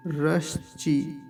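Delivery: रश्चि said slowly and softly; slow model. Shown as a demonstration. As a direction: sound out रश्चि carefully. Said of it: "इसको जल्दी बोलना है". (रश्चि धीरे धीरे)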